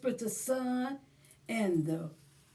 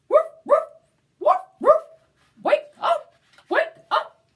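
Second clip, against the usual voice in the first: high, loud barking dog voice; cheering dog; 12 semitones higher, 9 dB louder than usual. Acted. Dog waking the baby, high loud excited barks. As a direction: bark it out like an excited puppy. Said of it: "cheered the dog".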